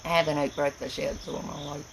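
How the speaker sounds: ordinary talk, nothing marked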